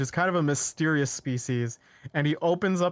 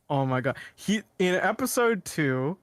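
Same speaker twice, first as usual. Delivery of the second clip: ordinary delivery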